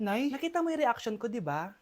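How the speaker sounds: ordinary talk, nothing marked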